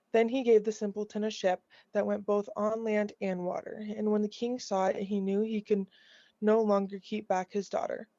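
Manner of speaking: ordinary delivery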